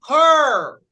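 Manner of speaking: "Her" loud, with a long R-coloured vowel; R-controlled vowel. Shown as a demonstration. Teacher modelling for repetition, loud "Her" with R-coloured vowel. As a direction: push out "Her", the R taking over the vowel. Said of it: "eu vou pronunciar er".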